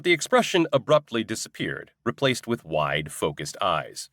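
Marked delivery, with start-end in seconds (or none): none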